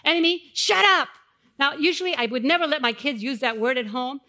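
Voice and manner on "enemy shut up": louder, declaring it; firm command. Retold or imitated. Friend teaching her to rebuke the enemy loudly. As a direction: tell the enemy off. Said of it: "say after me".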